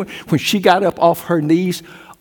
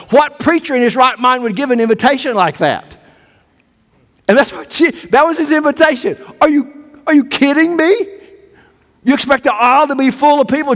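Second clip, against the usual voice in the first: incredulous; 8 semitones higher, faster than usual. Acted. Preacher mocks Jesus' hard invitation.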